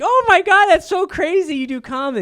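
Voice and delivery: high, gushing impression of a thrilled date. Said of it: "they like".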